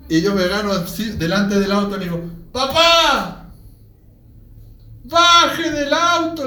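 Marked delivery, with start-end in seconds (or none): 2.5-6.5 high, loud, drawn-out call to father; retold desperate yelling; desperate shout. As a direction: cry out to stop the car. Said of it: "esos que gritan, pero desesperado".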